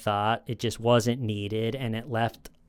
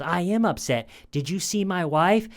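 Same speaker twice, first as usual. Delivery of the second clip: higher-pitched, mildly indignant imitation; upset retort; 7 semitones higher than usual. Suspect answers interrogator about his wife, imitated upset. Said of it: "I could see you're upset".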